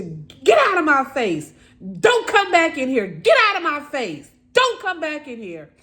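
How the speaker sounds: high, loud furious shouting, retold; angry full-voiced dismissal, punching each order; yelling, screaming mother. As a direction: imitate a mother screaming her child away. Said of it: "yelling and screaming".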